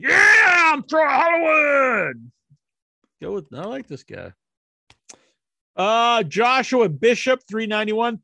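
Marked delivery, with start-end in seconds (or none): 0.0-2.3 much higher, louder hype shout; hammed-up wrestler boasting his name; excited wrestler shout. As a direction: yell it like a pumped-up wrestler. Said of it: "It's like".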